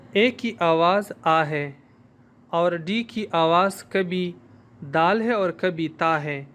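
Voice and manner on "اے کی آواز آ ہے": ordinary talk, nothing marked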